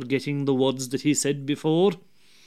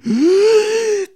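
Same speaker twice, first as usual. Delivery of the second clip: a big, high gasp drawing in air; huge inhaling gasp; 8 dB louder than usual. A narrator's reading. The devil breathing in to grow huge, a loud gasp. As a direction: swell up with one big breath. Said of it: "the devil breathed in".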